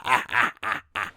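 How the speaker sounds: low, gloating demon laugh; demon chuckle. Acted. Demon laughs after revealing his secret, gloating. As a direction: chuckle darkly in separate bursts.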